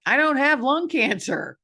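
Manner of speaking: a sudden loud reminder to herself; emphatic. Real, not acted. Emphatic realization.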